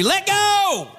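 high, urgent yell at the screen; high-pitched exasperated holler. Retold or imitated. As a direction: yell it like pleading with the TV. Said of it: "I'm yelling in the documentary".

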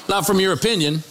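ordinary talk

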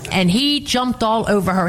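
ordinary delivery

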